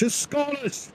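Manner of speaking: a light Scottish lilt on "It's Scottish"; quick Scottish correction; mock Scottish accent. Shown as a demonstration. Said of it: "I don't say it's Irish".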